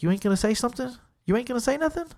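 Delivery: the young guy taunting the narrator to react, mocking. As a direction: goad him with a sneering lift. Bully taunting him in parking lot.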